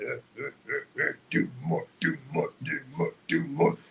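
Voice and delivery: rhythmic caveman grunting; ape-like inner voice. Acted. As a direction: grunt it out in short, even beats. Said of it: "I'm like an ape like a".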